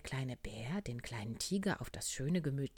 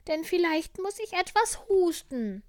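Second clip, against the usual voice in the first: sick little tiger; 11 dB louder than usual. Acted. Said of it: "sagte der kleine Tiger".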